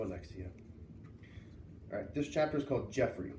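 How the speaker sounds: plain reading voice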